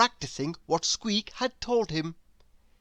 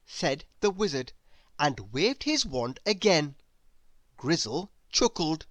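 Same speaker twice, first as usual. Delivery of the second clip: plain reading voice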